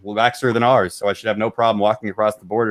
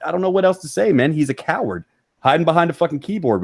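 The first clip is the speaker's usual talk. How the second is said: ordinary talk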